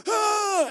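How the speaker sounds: high, loud excited yell; a wild rallying holler; charging bike-ride whoop. Retold or imitated. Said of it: "I am like Jehu".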